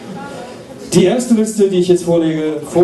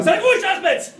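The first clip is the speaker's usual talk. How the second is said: squeaky high voice. Acted. Joke quote, tapping suitcase.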